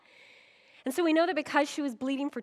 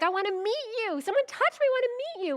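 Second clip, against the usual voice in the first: much higher, louder eager call; excited higher voice, wanting to meet her; 11 semitones higher, 4 dB louder, faster than usual. Retold or imitated. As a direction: reach out eagerly to the crowd.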